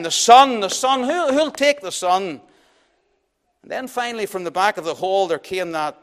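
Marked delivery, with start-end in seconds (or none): 0.0-2.4 loud, higher auctioneer cry, slowing at the end; auctioneer calling out. A narrator's reading. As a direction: call the lot across the hall. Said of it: "sounding out his word again".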